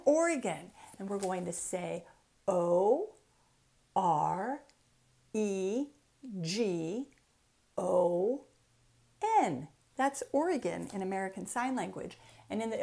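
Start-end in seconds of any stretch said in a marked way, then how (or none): none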